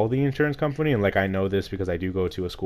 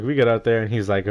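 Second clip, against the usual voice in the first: ordinary talk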